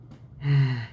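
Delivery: audible sigh. Acted. Character sighs before explaining to the other, sighing. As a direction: let out a sigh before answering.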